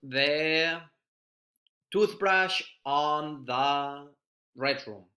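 I dropped the toothbrush said very slowly; teacher dictating a sample sentence; slow example sentence. Shown as a demonstration. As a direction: read it out word by word. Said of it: "Por ejemplo".

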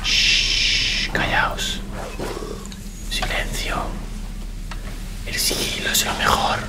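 hushed, voiceless sneaking whisper; stealthy whisper. Pretend. Player hushes everyone while sneaking, stealthy whisper. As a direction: hush them as if hiding.

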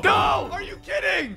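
very high cry of disbelief; dismayed yell. Real, not acted. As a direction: shriek at a sudden setback. Knocked down in game.

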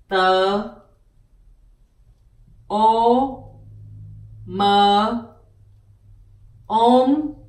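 o, m, om, tôm pulled apart, om stretched loud; sounding out. Shown as a demonstration. Teacher spelling tôm sound by sound for learners. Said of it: "Tôm is shrimps".

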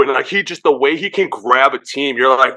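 ordinary talk

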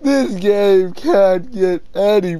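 slow, drawn-out wail of protest; tearful lament. Real, not acted. Gamer protests how sad this game is, tearful. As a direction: lament it word by word through tears. Tearful complaint right after sobbing.

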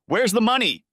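high, loud demand while shaking her; shouted. A narrator's reading. Darren shouting at Shannon about the missing money, high and loud. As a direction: shout it in her face, frantic. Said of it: "he shouted".

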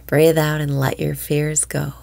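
ordinary talk, nothing marked

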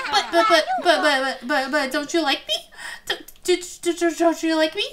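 playful stammering plea; rejected child's pleading. Acted. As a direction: stutter it out like a hurt little boy. Voices a rejected boy stammering.

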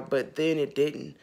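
ordinary talk

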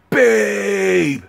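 high, loud, drawn-out holler; calling out. Retold or imitated. Man hollering for his girlfriend on getting home, calling out. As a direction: call through the house, stretching the vowel.

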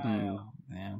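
ordinary talk, nothing marked

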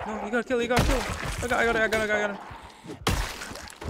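high, loud panicked babble; urgent mid-fight yelling; frantic. Real, not acted. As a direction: repeat it in a rising panic.

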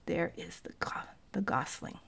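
soft unvoiced whisper. A narrator's reading. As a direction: whisper it so the geese won't hear. Hushed aside near the geese.

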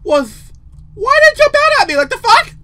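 high, loud panicked protest; real startled outrage; alarmed yelling. Real, not acted. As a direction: shout it, still shaken by a fright. Reacting to jump scare.

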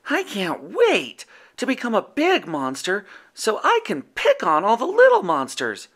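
eager little monster; high childlike Yeti. A narrator's reading. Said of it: "said Furball".

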